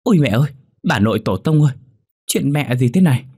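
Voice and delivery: frightened wail; trembling panic. Acted. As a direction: cry out in terror at his own body. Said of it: "Hắn run rẩy nói một mình".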